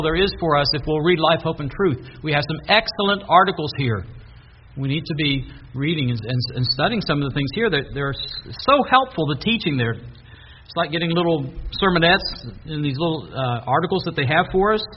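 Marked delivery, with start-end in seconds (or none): none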